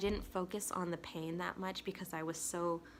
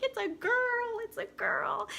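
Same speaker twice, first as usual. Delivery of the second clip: high, loud mock squeal of joy; imitating a thrilled, high-pitched announcement; excited cheering; 6 dB louder, slower than usual. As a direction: celebrate like a whole room cheering. Everyone's excited cry, retold.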